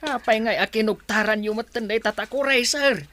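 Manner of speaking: high, loud pleading to the sir; pleading cry. Acted. Servant pleading with her master, high and loud. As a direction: beg him to look, voice rising and loud.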